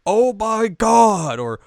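put-on high, loud, drawn-out old man yell in English; mock Old Man Joseph shouting Oh my God. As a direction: ham up a startled old man cursing. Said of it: "Just have him yell".

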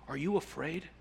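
a soft, breathless Mole, shaken by awe; trembling whisper. A narrator's reading. Mole asks Rat in the holy presence, a soft shaken whisper. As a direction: barely get the words out, shaking. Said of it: "he found the breath to whisper, shaking".

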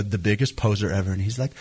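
usual speaking voice

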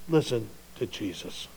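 God quietly correcting Andy; quiet divine rebuke. Pretend. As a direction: say it gently but with weight. Repeated soft command.

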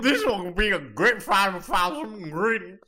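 loud, high put-on cartoon voice; hammed-up game-character line; cartoon character impression. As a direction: squawk it like an excited cartoon sidekick. Game character impression about ingredients.

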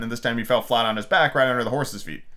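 ordinary delivery

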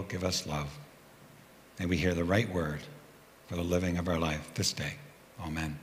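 prayer. Acted. Pastor praying over the congregation, low and hushed. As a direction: lead the congregation gently in prayer.

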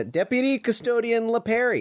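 "Deputy Custodian LePerry" said high in a put-on Italian-style accent, rolling the name LePerry; mock Italian accent. Shown as a demonstration. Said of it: "What accent was that?".

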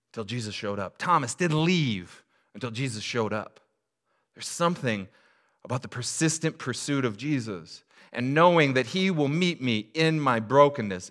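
preaching. Acted. Pastor preaching to the congregation, building. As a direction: lift it and press each line home.

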